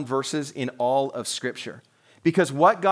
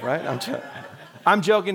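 ordinary talk

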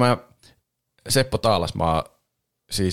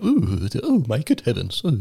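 hammed-up posh English butler voice, a little higher; posh British voice actor; 4 semitones higher, slower than usual. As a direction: imitate a fussy British manor voice. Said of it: "ääninäyttely on semmoista". (liioiteltu brittiläinen hovimestarin ääni)

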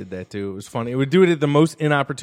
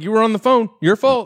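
blaming shout; 9 semitones higher, 6 dB louder than usual. Retold or imitated. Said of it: "then being like".

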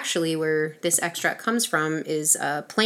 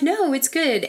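insisting friends; 10 semitones higher than usual. Retold or imitated. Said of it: "they were like".

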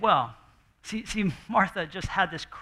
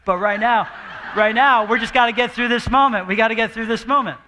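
louder, pushing through; frazzled parent; 4 semitones higher, 8 dB louder than usual. Retold or imitated. Parent yelling at kids, louder and frazzled. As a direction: grit it out loud.

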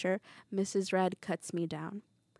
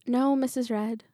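plain reading voice

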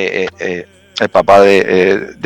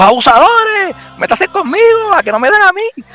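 angry yelling man. Retold or imitated. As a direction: yell it like an outraged neighbor confronting the soldiers. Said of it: "el tipo se enojó". (hombre furioso gritando, muy agudo)

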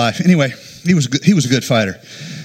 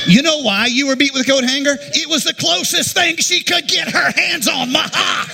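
high-pitched, worked-up outburst; comic rant; 9 semitones higher than usual. Acted. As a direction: build it to a squealing punchline. Comic rant on coat hanger beatings.

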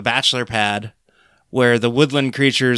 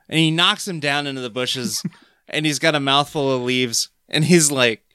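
normal talking voice

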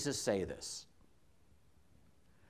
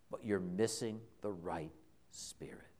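whispered rebuke; 9 semitones lower, 12 dB quieter than usual. Retold or imitated. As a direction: whisper it, grave and intimate. Jesus quoted correcting Peter.

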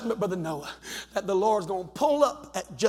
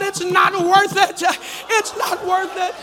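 high, slow, drawn-out pulpit cry; preacher's shout; 11 semitones higher, 4 dB louder, slower than usual. Real, not acted. Preacher urging somebody to jump up, slow high shout. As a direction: shout it slowly to rouse the room. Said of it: "jump to your feet and shout".

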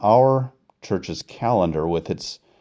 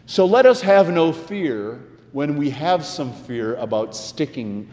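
earnest, rising preacher's cadence; sermon delivery; 6 semitones higher than usual. Acted. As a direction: preach it to the congregation.

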